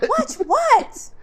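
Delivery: high, loud watch what; high incredulous. Retold or imitated. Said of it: "I'm looking at you like".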